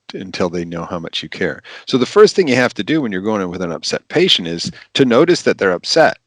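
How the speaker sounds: ordinary delivery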